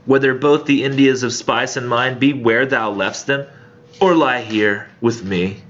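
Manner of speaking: dramatic verse reading; poem reading. Acted. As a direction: read it like a performed poem, with weight. Reads Donne poem aloud.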